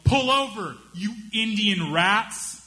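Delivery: high hammed-up shout, slow at the end; mock angry yell. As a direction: yell it from a car window, half in jest. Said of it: "he yelled".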